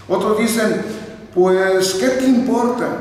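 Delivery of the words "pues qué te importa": "te importa" run together as "timporta"; te turned to ti. Retold or imitated. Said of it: "Ahí ya le cambiaron la E".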